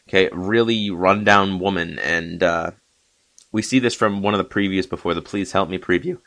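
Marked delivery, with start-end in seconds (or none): 5.8-6.2 accidental high squeak as the voice breaks; voice crack. Real, not acted. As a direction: voice cracking upward on the last syllable. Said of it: "Wow, that was a voice crack".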